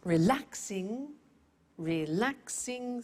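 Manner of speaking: Relaxing said slowly, re-lax-ing; syllables drawn out. Shown as a demonstration. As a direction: lay out each syllable of Relaxing.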